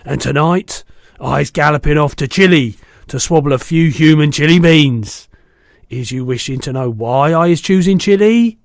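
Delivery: a big brute bragging loudly; loud giant. A narrator's reading. Bloodbottler mocking the BFG, loud and boastful.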